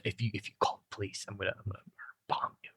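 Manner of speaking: very quiet mock threat, fading to a whisper; low, barely voiced menace; hushed. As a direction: drop right down as if not to be overheard. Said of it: "you might wanna say that a little bit louder".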